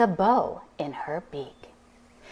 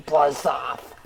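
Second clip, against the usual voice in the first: slow, growling monster; beast voice; 4 semitones lower, slower than usual. Acted. Four-headed beast warning off the dragon, slow growl. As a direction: snarl it low and slow. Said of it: "it said to the dragon".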